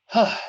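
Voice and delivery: small breathy sigh; little sigh. Acted. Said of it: "lets out a little sigh".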